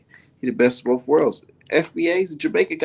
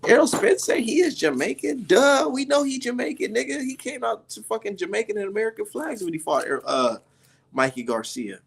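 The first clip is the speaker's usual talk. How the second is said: much higher mock commenter voice; hammed-up, much higher voice of know-it-all commenters; 9 semitones higher than usual. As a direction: squeak it out like a smug commenter. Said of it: "I see a whole lot of people in my comment section".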